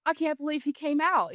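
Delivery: imitating an excited son; kid's excitement. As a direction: pitch it up with amazement. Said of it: "so excited".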